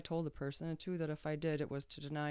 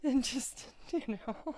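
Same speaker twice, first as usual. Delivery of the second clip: real laugh breaking through the words; laughing while talking; 9 semitones higher, slower than usual. Real, not acted. Amused by own impression of a friend, laughing. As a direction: talk through a laugh, higher pitched.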